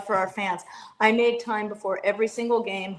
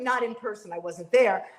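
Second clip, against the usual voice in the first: normal talking voice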